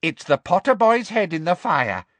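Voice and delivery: high creature voice with furtive triumph; house elf. A narrator's reading. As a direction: inform the room as a sly servant. House elf informing empty kitchen.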